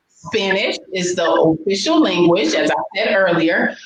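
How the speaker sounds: ordinary delivery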